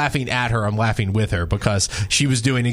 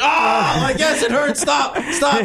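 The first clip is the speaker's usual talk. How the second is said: put-on pained injured kid; 13 semitones higher than usual. Retelling the injured player yelling at the trainer, high pained yelps. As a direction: squeal it like the hurt kid begging her to stop. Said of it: "And the kid's like".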